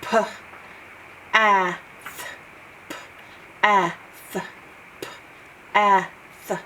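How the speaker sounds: "P, ah, th" split into separate sounds with pauses between; sounding out. Shown as a demonstration. Teacher sounding out path for pupils, each sound apart. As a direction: sound out p, ah and th one at a time. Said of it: "it makes its ah sound".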